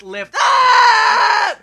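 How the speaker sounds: loud laughing shout on the name; excited announcement. Real, not acted. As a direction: belt out the ride name with a laugh. Announcing next coaster.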